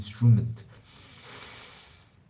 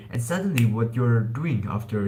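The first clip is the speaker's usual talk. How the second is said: usual speaking voice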